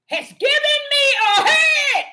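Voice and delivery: high, loud, drawn-out cry; emphatic proclamation. Acted. Preacher declaring Ahia has given a head, emphatic cry. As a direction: belt it out slowly, high and loud.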